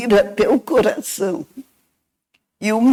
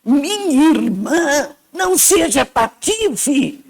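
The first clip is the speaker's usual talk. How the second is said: lightly voiced scolding brother, a touch higher; 4 semitones higher than usual. A hint of the dead brother scolding his sister. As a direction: give a gentle reprimand from beyond. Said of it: "Ele disse pra mim".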